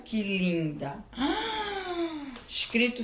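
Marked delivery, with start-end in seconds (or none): 1.1-2.4 a breathy little gasp, much higher; quiet delighted gasp; soft high gasp. Real, not acted. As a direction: catch your breath at something lovely. Sees the towel.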